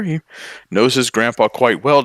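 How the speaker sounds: usual speaking voice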